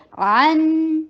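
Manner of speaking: عن with the ن held a medium beat; medium hold on nun. Shown as a demonstration. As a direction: hold the ن of عن briefly. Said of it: "نون کے اوپر توسط کا ٹائم دیجیے". (عن میں نون پر توسط کا وقت)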